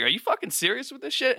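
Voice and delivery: high, incredulous exasperation; can't believe this mess; exasperated outburst. Acted. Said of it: "It's like".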